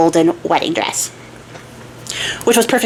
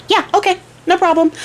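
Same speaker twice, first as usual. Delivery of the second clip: high, easy instant agreement; breezily agreeable; 10 semitones higher than usual. Retold or imitated. King agreeing at once to his bride's demand, high and breezy. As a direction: agree in a lightly voiced high pitch.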